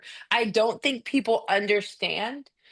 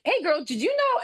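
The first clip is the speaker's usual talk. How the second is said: teasing; 9 semitones higher than usual. Retold or imitated. Coach teasing the mom.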